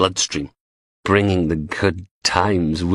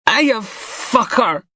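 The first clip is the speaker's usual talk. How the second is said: slow, very high sneering hello; mocking greeting; much slower than usual. A narrator's reading. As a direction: taunt him with a drawn-out, sneering hello. Blondie greets the beaten dealer.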